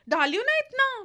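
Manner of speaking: high, loud cook imitation; cook's reply.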